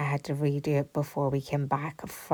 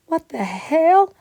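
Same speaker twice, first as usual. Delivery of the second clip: much higher and louder, slow and drawn out; exasperated outburst; 13 semitones higher, 11 dB louder, slower than usual. Retold or imitated. Owner scolding the dog that hid all along, in a loud slow outburst. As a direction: let the disbelief burst out.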